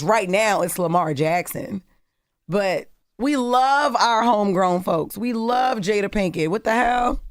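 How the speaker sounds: ordinary delivery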